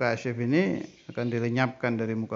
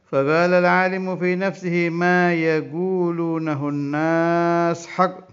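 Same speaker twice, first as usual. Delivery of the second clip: slow, raised Arabic reading voice, drawn out; kitab recitation; 6 semitones higher, 7 dB louder, slower than usual. Acted. Teacher reading the Arabic kitab to students, slow raised recitation. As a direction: intone the Arabic text like a pesantren teacher.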